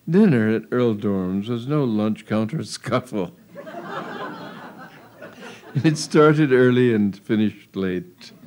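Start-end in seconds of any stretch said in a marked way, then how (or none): 3.6-4.7 soft amused chuckle; a quick breathy giggle; light laugh. Real, not acted. Narrator laughs at own reading.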